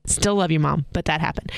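usual speaking voice

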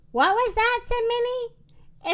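surprised storybook character; startled question. A narrator's reading. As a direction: ask it in sudden surprise, pitched right up. Startled cow hears turkeys.

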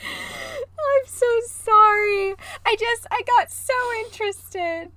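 high laughing, slow drawn-out sorry; laughing apology. Real, not acted. Apologizing to her spooked cohost while laughing high. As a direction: giggle through a fake apology.

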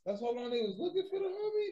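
shocked shout. Real, not acted. Shocked at three months captivity.

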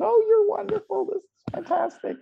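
high, gushing mock parent praise. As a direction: play the doting mom who loves everything. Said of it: "your mom or your dad that says".

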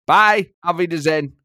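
loud, abrupt German sign-off; Thomas Frank. Acted. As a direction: storm off in character as a German manager. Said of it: "Thomas Frank, it's been a pleasure".

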